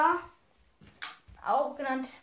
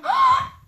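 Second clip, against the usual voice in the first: sharp high gasp before a cough; big gasp; 10 dB louder than usual. Acted. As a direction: suck in air loudly. Huge cough coming.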